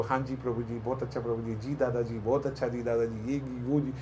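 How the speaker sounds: soft, fawning yes-sir talk; hushed obsequious flattery; mock sweet-talking an elder; overly polite. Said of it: "आप ऐसी बात करो".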